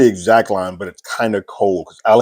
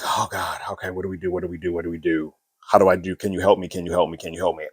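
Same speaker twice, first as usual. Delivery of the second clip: hushed, frantic pleading, much softer; mock panic; 2 semitones lower, 7 dB quieter, faster than usual. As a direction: mimic a ruler overwhelmed and begging for help, quietly. Rhaenyra swamped by requests, panicking.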